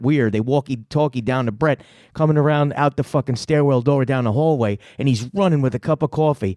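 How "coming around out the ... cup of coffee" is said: ordinary delivery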